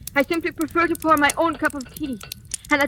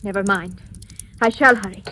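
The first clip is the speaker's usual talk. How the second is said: ordinary talk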